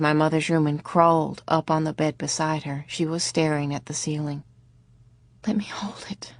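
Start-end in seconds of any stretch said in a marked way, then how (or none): 5.5-6.3 whisper. A narrator's reading. Dazed mother asks for dead baby.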